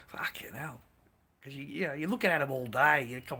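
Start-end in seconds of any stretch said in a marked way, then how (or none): 0.1-0.8 breathy whispered curse under the breath. Real, not acted. Talking to the boys, a hushed whispered curse. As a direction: let it slip out as a hushed whisper. Aside about watching them all day.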